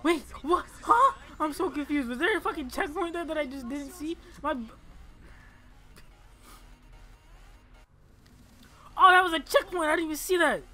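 high, loud baffled outburst; confused high yelling. Real, not acted. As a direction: shout in confusion.

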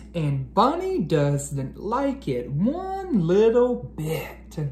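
bedtime picture book reading; reading to children. Acted.

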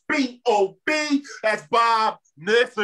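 spelled out slowly then shouted; hype intro. Real, not acted. Host hyping Bob to the crowd, slow spelled-out shout. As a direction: hype the name like a boxing announcer.

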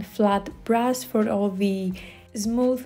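ordinary talk, nothing marked

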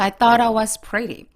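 slow demo, "thought I" joined as one word; linked reading. Shown as a demonstration. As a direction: flow from "thought" into "I" without a break. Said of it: "이렇게 단어, 단어끼리". ("thought I"를 붙여서 천천히)

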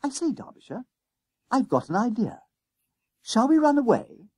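high-pitched schoolboy eagerness; struck by an idea. A narrator's reading. Jennings springs escape plan on Derbyshire, eager and conspiratorial. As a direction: propose a daring plan, pitched right up. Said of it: "A brilliant thought struck him".

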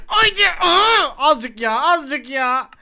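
high, loud burst into a cry; urgent yell. Real, not acted. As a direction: shout it as you jump. Atlarken bağırıyor. (tiz, telaşlı bağırış)

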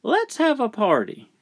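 put-on high, childlike suggestion; high-pitched eager child; child proposing.

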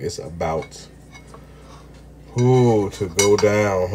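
slow, stretched to go down; slow teasing build-up before the bite; drawn-out catchphrase. Acted. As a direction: savour every word of it. Repeating the catchphrase.

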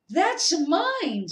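high, loud protest. A narrator's reading. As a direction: cry out to get it back. Harry snatching letter.

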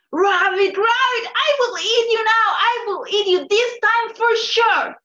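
high, loud calls after the rabbit; calling out. Acted. Coyote running after the rabbit, calling loudly. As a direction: yell ahead like a hungry hunter.

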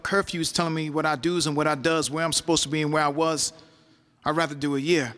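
measured rhythmic poetry delivery; spoken word poem. Acted. Poet recounting taking the year in prison, spoken word. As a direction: perform it like a slam poem, riding the rhythm.

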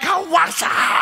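high, slow mock-tough yell; hammed-up street challenge; a disciple squaring up to the guards, mock yell. Disciple confronting arresting crowd.

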